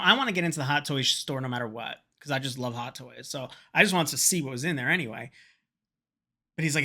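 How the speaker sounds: ordinary talk, nothing marked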